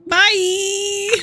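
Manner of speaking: high, sing-song goodbye; chirpy farewell. Pretend. Player replying to the boss, high sing-song goodbye. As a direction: answer the boss in a bright mock-cheery tone.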